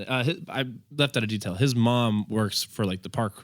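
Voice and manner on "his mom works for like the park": ordinary delivery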